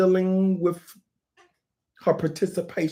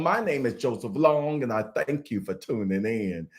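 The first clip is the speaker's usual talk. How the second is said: usual speaking voice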